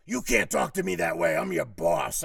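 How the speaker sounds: very loud put-on boss outburst; indignant mock roar, pulling rank; retold yelling boss. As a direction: imitate a boss yelling down the phone. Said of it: "he started yelling at me".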